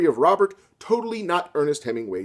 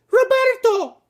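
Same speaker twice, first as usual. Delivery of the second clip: much higher, loud falsetto cry; Maria calling; 7 dB louder, slower than usual. Acted. Maria crying out to Robert in a high falsetto. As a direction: call out for her lover.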